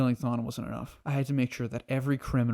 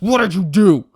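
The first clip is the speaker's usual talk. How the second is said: much higher, much louder yell; furious shout; 8 semitones higher, 15 dB louder than usual. Retold or imitated. Savitar pinning Barry to the wall, furious shout. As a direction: roar it into his face. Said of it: "He's like".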